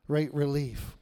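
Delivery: ordinary delivery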